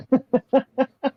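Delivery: high burst of giggling; real high-pitched laugh. Real, not acted. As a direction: giggle at your own joke. Laughing at own joke.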